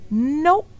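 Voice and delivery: high, loud flat refusal; little sister firmly refusing. Acted. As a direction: shut it down with one word. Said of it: "D W said firmly".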